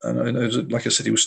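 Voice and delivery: ordinary talk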